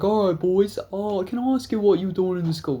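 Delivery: mimicking the officer's formal introduction to the boys; imitating a cop. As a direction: do the stiff cop introducing himself. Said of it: "And so he's like".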